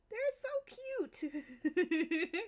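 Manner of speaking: adoring laughing coo; slow laughing gush. Real, not acted. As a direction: melt over how cute they are, through a laugh. Gushing over cute faces.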